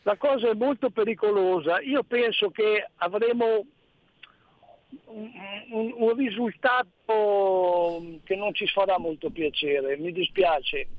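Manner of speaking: ordinary delivery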